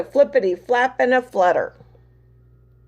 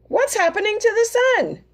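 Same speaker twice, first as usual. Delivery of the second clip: much higher panicked pig voice; frightened pig; 11 semitones higher than usual. Acted. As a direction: squeak the question in fright. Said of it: "squealed Pig".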